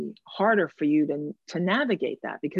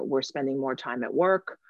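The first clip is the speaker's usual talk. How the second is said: ordinary talk, nothing marked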